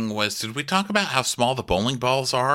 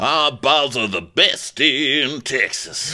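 slow, loud, high boasting drawl; mock Texan brag; 4 semitones higher, 3 dB louder, much slower than usual. Co-host mocking Texas bowling balls, slow proud brag.

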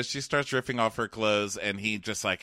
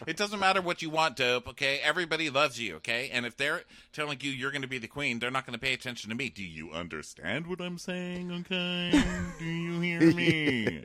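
higher, fast nagging queen rant; mock bossy queen; 5 semitones higher than usual. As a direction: rattle off her demands, bossy. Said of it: "And she's like".

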